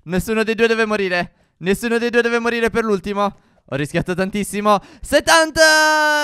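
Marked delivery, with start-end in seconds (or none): 5.0-6.2 loud, drawn-out victory shout; triumphant shout. Real, not acted. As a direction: stretch it out in triumph. Final level reached.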